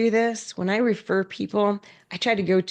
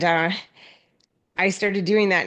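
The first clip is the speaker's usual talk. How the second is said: ordinary talk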